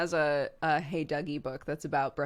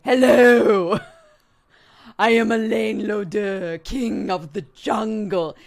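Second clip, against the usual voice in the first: loud, high, booming lion king voice with a laugh; dapper lion voice; 5 semitones higher, 13 dB louder, slower than usual. Shown as a demonstration. Reading to Clark, a very loud, grand dapper lion voice. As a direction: ham up a grand, pompous lion. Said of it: "the way that the lion talks is like".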